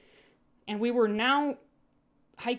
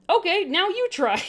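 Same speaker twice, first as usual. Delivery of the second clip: cheerful prompt; 10 semitones higher, 7 dB louder than usual. Acted. Narrator after singing, encouraging.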